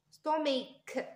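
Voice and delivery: "ستوميك" ending on a released hard k; k sound. Shown as a demonstration. Teacher asking pupils what they hear, "ستوميك" with clear k. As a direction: say "ستوميك" carefully, sounding the final k. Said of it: "نسمعوا في الك ساوند هنا".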